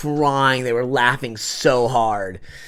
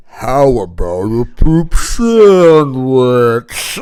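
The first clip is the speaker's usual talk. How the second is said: slow, louder ad-lib line; slow mock yell; 3 semitones lower, 7 dB louder, much slower than usual. Ad-libbing into sudden silence, slow loud line. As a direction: drag out poop sandwich deliberately. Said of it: "and I was like".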